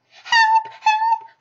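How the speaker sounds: very high, loud squeal of help; panicked cry. A narrator's reading. Piglet sees the Heatherlump and screams in panic. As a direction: scream for help in a tiny terrified voice. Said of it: "cried Piglet".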